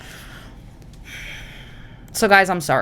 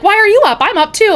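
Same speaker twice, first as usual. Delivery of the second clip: high, loud excited mom voice; mock mom chirping at midnight; hammed-up excited mom; imitating mom; 13 semitones higher, 6 dB louder than usual. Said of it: "you were like".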